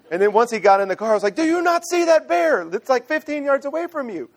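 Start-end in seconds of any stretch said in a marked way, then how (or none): 1.3-4.3 high-pitched, incredulous alarm; alarmed exclamation. Retold or imitated. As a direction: exclaim in disbelief at his obliviousness. Alarmed father scolds oblivious son.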